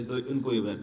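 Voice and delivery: ordinary talk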